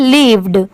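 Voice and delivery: "lived" said with a clear final d; final d sounded. Shown as a demonstration. Teacher showing students the d at the end. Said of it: "इसको क्या पढ़ेंगे".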